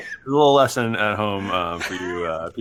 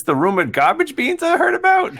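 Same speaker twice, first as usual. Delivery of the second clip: high, loud character voice; Jimmy; 5 dB louder than usual. Acted. Jimmy asks Scud about rumored beans, high voice. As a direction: climb high on the incredulous question.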